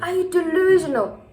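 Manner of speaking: loud, high-pitched incredulous challenge; indignant outburst. Retold or imitated. Confronting her crying best friend in a retold quarrel, indignant outburst.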